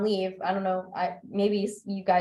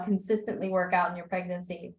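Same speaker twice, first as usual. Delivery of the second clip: usual speaking voice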